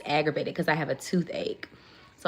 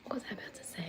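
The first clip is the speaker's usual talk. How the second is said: whisper; 3 semitones higher, 15 dB quieter, much faster than usual. Real, not acted. Muttering to herself on live.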